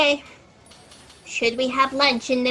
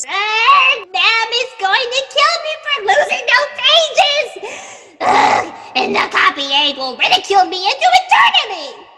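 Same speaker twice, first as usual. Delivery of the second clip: panicked; 8 semitones higher, 5 dB louder than usual. Acted. Panicking about losing the pages.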